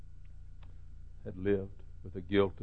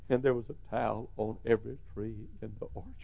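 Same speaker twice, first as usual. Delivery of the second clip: ordinary delivery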